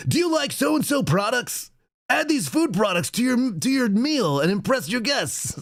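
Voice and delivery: high, slow hammed-up old announcer; Harry Carey ad. Host imitating Harry Carey reading a food ad. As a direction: ham it up like a tipsy seventies pitchman. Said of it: "He's like".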